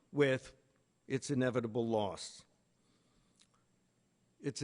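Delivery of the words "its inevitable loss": usual speaking voice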